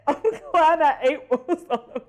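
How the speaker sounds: laughing through the words, high and loud. Real, not acted. Streamer laughs at her clumsy spill while talking. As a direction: talk while cracking up.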